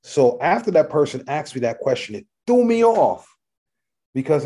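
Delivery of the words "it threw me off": usual speaking voice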